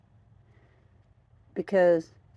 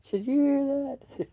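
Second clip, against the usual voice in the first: much higher, cooing pet voice; pet talk; 13 semitones higher, 5 dB louder than usual. Real, not acted. Owner asks her cat if it heard, high cooing pet talk.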